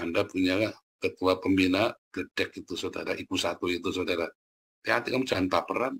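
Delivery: normal talking voice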